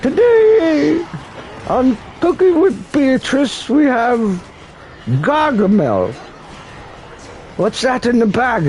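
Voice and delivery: high, bright-pitched TV host voice; cooking show host. Pretend. TV host introducing guest Gargamel, high and chirpy. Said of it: "I wanna see Gargamel on a cooking show".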